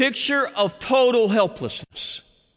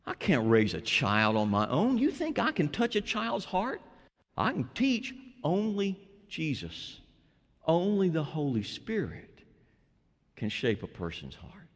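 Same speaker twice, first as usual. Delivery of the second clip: soft helpless confession; 2 semitones lower, 8 dB quieter than usual. Acted. Believer's helpless confession, voiced softly.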